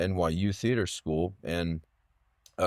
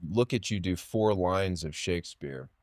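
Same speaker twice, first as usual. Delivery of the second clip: ordinary talk, nothing marked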